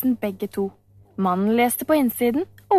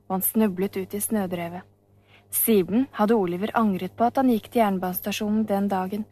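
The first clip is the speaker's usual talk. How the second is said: usual speaking voice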